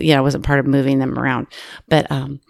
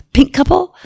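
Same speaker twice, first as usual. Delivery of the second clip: retold puzzled check, rising sharply; puzzled query; 10 semitones higher than usual. Flight attendant checking who the passenger means, puzzled. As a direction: pitch jumping up into a question.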